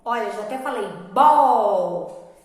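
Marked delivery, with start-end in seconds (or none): 1.1-2.3 "bol" drawn out with force; strong syllable alone. Shown as a demonstration. Teacher admitting she gave away the answer, emphatic long syllable. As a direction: stretch "bol" as the stressed beat. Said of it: "que é a sílaba mais forte".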